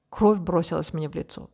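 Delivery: ordinary delivery, nothing marked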